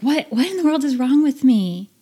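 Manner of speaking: mock-frustrated self-questioning. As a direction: vent at your own failure. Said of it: "I was like".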